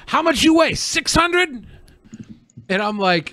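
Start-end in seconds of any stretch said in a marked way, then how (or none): 0.1-1.7 high, louder mock-incredulous questioning; imitated nosy stranger. Stranger guessing a customer's weight, imitated incredulous question. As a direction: play the stranger pressing in disbelief. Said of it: "And he was like".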